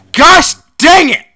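exasperated cry. Real, not acted. Exasperated outburst at autocorrect.

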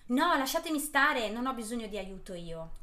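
put-on snapping rudely at the kids; rude and aggressive. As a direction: push them away with a rude bark. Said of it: "in modo alquanto scortese e aggressivo". (scortese e aggressivo)

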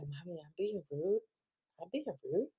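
small, soft imitation of a puzzled kid; hushed mock child voice, questioning. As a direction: ask softly like a little girl, ending on a rise. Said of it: "she'll question".

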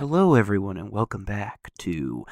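soothing NPR intro delivery; NPR host voice. Retold or imitated. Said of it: "that's what every single, like, person on N P R sounds like".

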